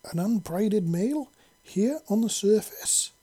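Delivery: high, excited disbelief; surprised exclaim. A narrator's reading. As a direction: gasp out the surprise. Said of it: "a couple of brides exclaimed".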